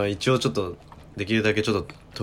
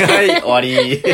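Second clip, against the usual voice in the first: laughing; 9 semitones higher, 7 dB louder than usual. Real, not acted. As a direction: laugh it out, loud. Laughing as topic ends fast.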